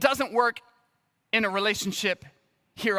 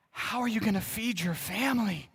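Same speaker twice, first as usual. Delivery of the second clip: imitating a grave, concerned adult; lightly voiced, mock solemn worry; mock serious question; 4 dB quieter than usual. Pastor imitates people doubting his ministry plans, mock serious. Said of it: "people would look at you with all seriousness".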